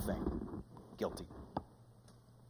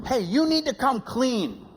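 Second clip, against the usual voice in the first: mock scolding; 11 semitones higher, 4 dB louder, slower than usual. Well-meaning church people scolding.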